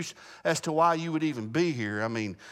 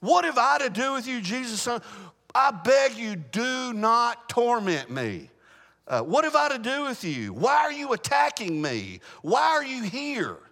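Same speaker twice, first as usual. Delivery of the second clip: demon's plea; 5 semitones higher than usual. Acted. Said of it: "look at the words of the demon here".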